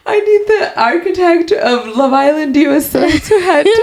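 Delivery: high-pitched quote through giggles; laughing imitation. Retelling a funny contestant's line to a friend, high and laughing. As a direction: imitate her up high, cracking up.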